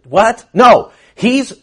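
usual speaking voice

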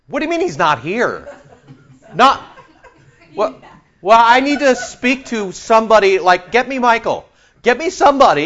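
high, loud, put-out demands for a manager; indignant demand. Pretend. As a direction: insist like an upset customer. Newcomer demands to see someone.

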